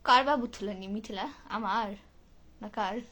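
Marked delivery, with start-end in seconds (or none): none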